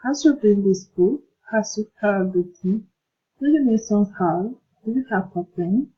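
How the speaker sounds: each question climbs on its last word, "book", "thing", "help", "pen"; rising yes-no questions. Shown as a demonstration. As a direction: textbook rise at the end of each question, up on "pen". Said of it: "General question, teasing intonation".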